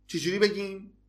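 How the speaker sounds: usual speaking voice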